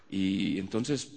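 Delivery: ordinary talk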